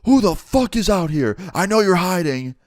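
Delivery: put-on high, loud, menacing shout at the hidden kids; armed owner shouting into the dark; angry yell. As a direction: bark it out like a gunman searching the junkyard. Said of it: "and he goes".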